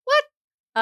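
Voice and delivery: sharp, high, loud disbelieving what; shocked what. Real, not acted. Co-host told one sentence with a semicolon, shocked high loud what.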